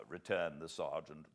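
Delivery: ordinary delivery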